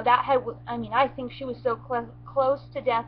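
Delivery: ordinary talk, nothing marked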